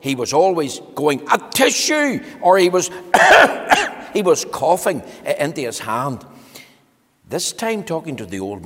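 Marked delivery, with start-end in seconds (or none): 1.3-2.2 high loud "A tissue" sneeze; big sneeze. Acted. Mister Sneeze sneezes over breakfast cornflakes. Always sneezing since birth. 2.9-4.1 loud hacking cough; acted cough. Mister Sneeze coughs into his hand.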